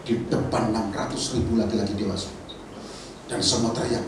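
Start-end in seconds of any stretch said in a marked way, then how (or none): none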